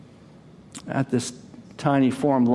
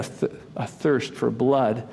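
normal talking voice

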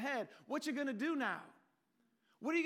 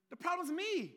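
higher, pressing down on me; stress on me; 8 semitones higher, 3 dB louder than usual. Real, not acted. Preacher confessing the problem is himself, stressing me. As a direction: land hard on me. Climax of his realization.

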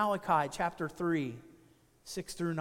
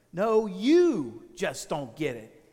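serene; 4 semitones lower, 5 dB louder, slower than usual. Retold or imitated. Calm castaway answering his panicking friend serenely. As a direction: lower and slow, "you just don't get it" said unhurried. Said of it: "said with great serenity".

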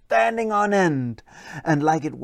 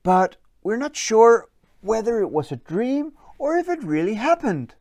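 higher, wondering little troll voice; higher curious boy troll; curious young troll; 5 semitones higher than usual. Acted. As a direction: ask it like a puzzled kid. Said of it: "with a curious look on his face".

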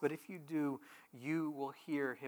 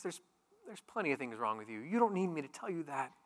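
normal talking voice

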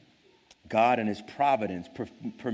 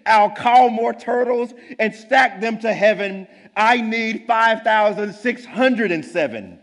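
boastful king; 10 semitones higher, 12 dB louder than usual. Acted. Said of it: "snorted Yertle".

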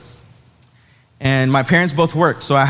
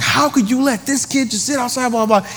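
imitated dad's outrage, high-pitched; mock scolding in a high, indignant run; angry telling-off; 7 semitones higher than usual. Dad tearing into the check-in guy, indignant. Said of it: "he was just tearing this guy a new one".

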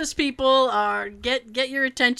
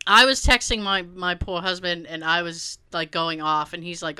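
ordinary delivery

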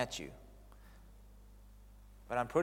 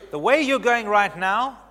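stern warning; 6 semitones higher, 10 dB louder, slower than usual. Retold or imitated. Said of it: "Moses is basically saying this".

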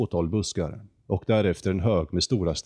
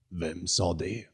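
softer, teasing echo of the question; mocking echo; 5 dB quieter, slower than usual. The condor mocks Jonathan by echoing his question softly. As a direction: mimic him back softly. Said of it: "härmade rösten".

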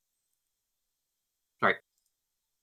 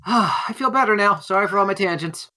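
in-character relief; 7 semitones higher than usual. Acted. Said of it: "I just turned to you guys and go".

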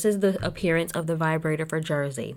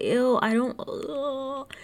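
disgusted; 8 semitones higher, much slower than usual. Real, not acted. Disgust at Teresa's talk.

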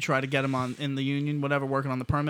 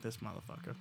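muttered under breath; 3 semitones lower, 16 dB quieter than usual. Retold or imitated. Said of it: "she was just like".

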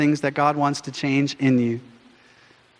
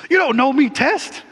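high, loud mock indignation; indignant retort; 10 semitones higher, 3 dB louder than usual. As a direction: snap back at the test, offended. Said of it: "it's like".

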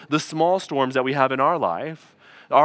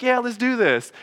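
mock-eager, pitched higher; eager crowd; 6 semitones higher than usual. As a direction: play the hyped-up crowd. People eager in retold story.

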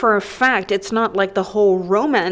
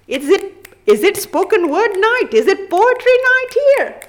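spoken word poet; 11 semitones higher, 5 dB louder than usual. Pretend. Mock spoken word performance.

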